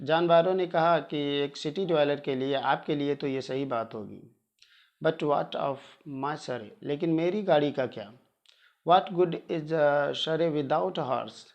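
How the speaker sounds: plain reading voice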